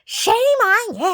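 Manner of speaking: high, loud, drawn-out imitation; slow, mocking high-pitched shout; mock scolding yell. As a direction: stretch it out like an outraged neighbor hollering. Mimicking neighbor's scolding again.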